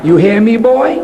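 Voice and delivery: lightly voiced West Indian mother, stern; a hint of a West Indian mother scolding; West Indian accent. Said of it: "in her beautiful West Indian accent".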